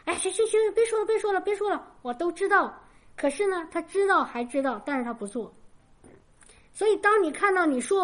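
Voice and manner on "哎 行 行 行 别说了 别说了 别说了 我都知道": imitating an impatient son, higher; brush-off in a mock weary, raised voice; rebellious teen. Mother imitates her rebellious son waving off her nagging. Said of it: "逆反".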